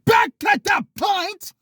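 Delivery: very high, loud, drawn-out yell; loud shout. Acted. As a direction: yell it high, stretching every word. Yanks self back on topic.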